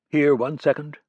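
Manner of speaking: ordinary delivery, nothing marked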